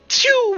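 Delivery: high whooshing "pew" of a fired bolt; sharp vocal projectile noise; bolt-shot sound effect. Acted. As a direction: imitate a crossbow bolt zipping away. Imitates bolt being loosed.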